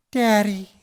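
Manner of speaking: put-on high little kid calling daddy; high toddler voice saying Daddy; child's voice. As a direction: play the infant, sweet and high. Child voiced in retold gag.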